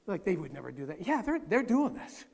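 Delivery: ordinary delivery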